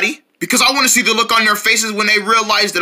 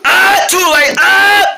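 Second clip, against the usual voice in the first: scream; 9 semitones higher, 5 dB louder, slower than usual. Acted. Logo catches him.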